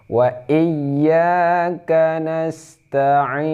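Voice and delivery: high, very slow chant; Quran recitation. Shown as a demonstration. Teacher reciting the fifth verse, high slow chant. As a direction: draw out each syllable melodically. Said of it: "ada yang baca G seperti G ya".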